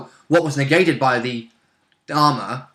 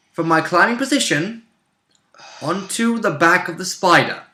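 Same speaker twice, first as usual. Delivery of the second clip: slow, high-pitched build-up; suspenseful, measured declaration with big pauses; dramatic story narration; 6 semitones higher, slower than usual. Acted. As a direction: draw out the heroic announcement in deliberate chunks. Dramatically recounts a character's leap.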